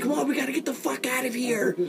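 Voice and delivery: urgent high rush. Acted. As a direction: rush them out. Urgent escape line.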